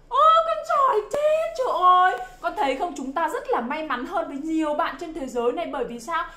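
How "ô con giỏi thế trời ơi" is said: high, loud, stretched-out gush; amazed praise. Pretend. Teacher praising a child who read "bear", amazed praise. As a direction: exclaim loudly and high, wowed by a child.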